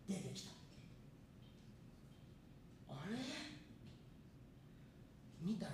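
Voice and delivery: normal talking voice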